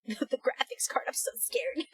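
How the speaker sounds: nervous giggly whisper. Real, not acted. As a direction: whisper it, half laughing. Nervous about opening PC.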